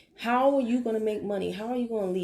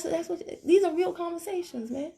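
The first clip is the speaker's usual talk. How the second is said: ordinary talk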